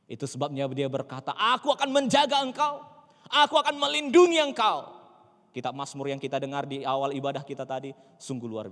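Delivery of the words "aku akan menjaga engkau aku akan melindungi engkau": high, loud proclamation of God's words; booming divine promise. Acted. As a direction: declare it loudly as a solemn promise. Declaring God's promise at full force. (seruan janji Tuhan yang lantang)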